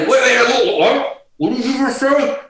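high, startled mock reaction; stunned disbelief. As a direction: blurt it high, as if you can't believe it. Acting out shock at blurting quit.

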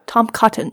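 Tom Cotton, stress on Tom; careful pronunciation. Shown as a demonstration. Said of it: "mispronouncing his name".

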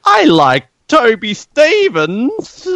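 drawn-out, high put-on voice; slow, high mock villain drawl; mock Toby Stevens. Co-host jokes in a slow high put-on voice.

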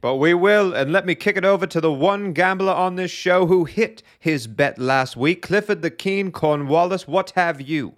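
high, booming English gentleman host; hearty booming country squire. Acted. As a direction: play a jolly old country squire hosting the show. Host's squire persona again.

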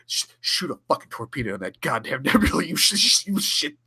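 mock-gruff Sulu swearing, laughing through it; a half-laughing captain's order; Sulu barking orders. Sulu orders Tuvok to fire, mock-gruff and laughing. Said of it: "is like, to Tuvok".

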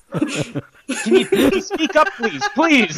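very high, exasperated plea; comic pleading. Acted. Interviewer asking whispering henchman to speak up, pleading. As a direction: beg through laughter to be able to hear.

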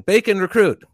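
high, loud recruit's order; recruit sound-off. Retold or imitated. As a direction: call it out like a recruit in line. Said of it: "So I get the bacon".

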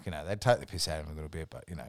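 ordinary talk

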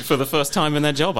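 ordinary delivery

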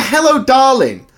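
mimicking his greeting. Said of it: "I've heard you say that a million times".

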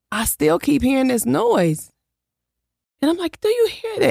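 rising alarm. Retold or imitated. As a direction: jump way up in pitch, insisting he hears it. Retelling rising alarm at noise.

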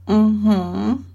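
muffled mm-hmm around a mouthful; mouth full. Acted. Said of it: "only thing I could get out with my mouth being used".

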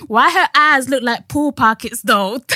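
mock American accent. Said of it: "I even read it in an American accent".

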